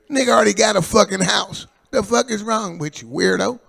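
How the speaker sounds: loud indignant rant; mock outrage. Real, not acted. Granddad ranting at his little grandson, loud mock outrage.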